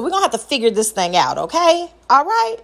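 sing-out high questioning tone; playful, very high question; high-pitched checking. Shown as a demonstration. Trying out a happy-medium tone.

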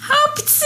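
sudden high sneeze; a big squeaky sneeze. Acted. Gréta sneezes out the doctor, high sneeze. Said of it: "Gréta akkorát tüsszentett".

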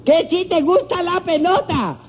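very high, insistent holler; shouting to the deaf. Acted. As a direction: repeat it for someone who can't hear. Repeating for a deaf man.

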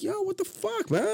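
sudden high-pitched indignant yelp; retold outrage. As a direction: leap up in pitch, outraged at the guest. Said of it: "And I was like".

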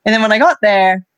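ordinary talk, nothing marked

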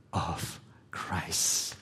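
soft dropped whisper. Real, not acted. Preacher telling the church they are the bride: whisper. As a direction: let it fall to a whisper.

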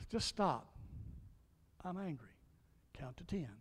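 soft inner voice, talking yourself down; quiet self-coaching through anger; calming self-talk. Retold or imitated. Preacher voicing an angry man calming himself, softly.

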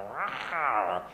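a small roar that rises and drops away; half-hearted cub roar, no real force; feeble lion roar. Acted. Cornered cub roars at laughing hyenas, feeble roar. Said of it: "he kinda roars at them".

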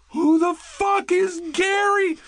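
very high, drawn-out squeal of bewilderment; mock freaked-out shriek. Tripping friends answering the helicopter, mock freaked-out shriek. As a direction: shriek it slowly like a panicked tripper. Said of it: "They were all fucking freaking out".

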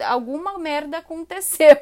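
loud alarmed blurt; alarmed reaction. Retold or imitated. Hearing an angry foreign voice, a loud alarmed blurt. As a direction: blurt it out loud like something went wrong. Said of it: "num tom de voz bravo".

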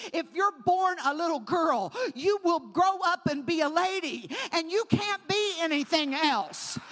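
loud, driving sermon; loud preacher declaring; full-force preaching. Acted.

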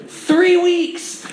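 very high, incredulous emphasis; high, astonished pulpit cry; amazed exclamation. Real, not acted. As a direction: exclaim it in wonder. Preacher amazed at short time.

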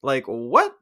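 baffled retort. Retold or imitated. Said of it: "And I was like".

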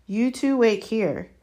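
mild shout, each word firm; shouted order. A narrator's reading. Gamekeeper orders children before rushing off, mildly shouted. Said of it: "Hagrid shouted".

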